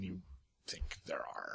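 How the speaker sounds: whispered secret aside; whispering. Real, not acted. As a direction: drop to a whisper.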